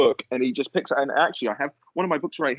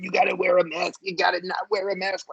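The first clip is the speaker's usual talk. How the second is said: mocking Fauci; 3 semitones higher than usual. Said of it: "starts going".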